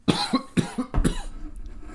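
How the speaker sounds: sudden sputtering coughing fit; choking cough. Real, not acted. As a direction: cough hard, choking on coffee. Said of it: "Sorry, just choking on my coffee".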